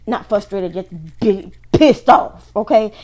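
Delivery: anger bursting loud on PISSED OFF; big pissed off. Real, not acted. Reader correcting frustrated to pissed off, loudly angry. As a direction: build to a loud, angry peak.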